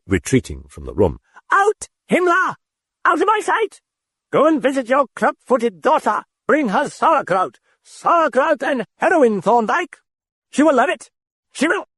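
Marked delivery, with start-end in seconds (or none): 1.5-11.9 put-on very high, raving British accent. A narrator's reading. The British voice cursing Carl as Himmler. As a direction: spit the insults in British fury.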